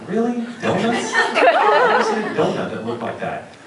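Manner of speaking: high, loud disbelief breaking into laughter; incredulous mock protest. Actor mocking rice balls turned into donuts, loudly incredulous. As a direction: question it in comic, high-pitched amazement. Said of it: "I'm like".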